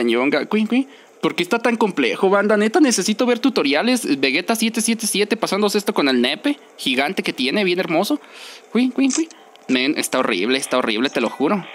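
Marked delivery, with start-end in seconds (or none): none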